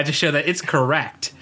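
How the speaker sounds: usual speaking voice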